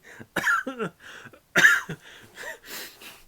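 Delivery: loud rising cough-laugh; choking on laughter; laughing coughing fit. Real, not acted. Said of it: "why was that so funny".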